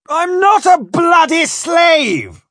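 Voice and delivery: high, drawn-out indignant yell; furious shout. Acted. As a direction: shout it at the top of your voice. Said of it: "I shouted".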